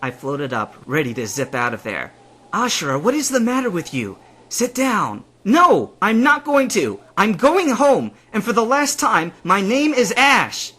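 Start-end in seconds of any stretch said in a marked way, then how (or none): 2.5-5.2 raised, louder reproach; exasperated scolding. A narrator's reading. Scolding the one storming off. 5.4-10.7 high, loud, drawn-out refusal; angry insistence. A narrator's reading.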